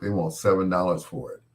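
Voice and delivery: ordinary talk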